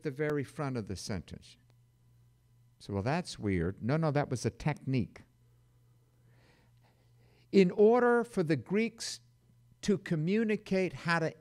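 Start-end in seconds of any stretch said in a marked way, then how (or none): none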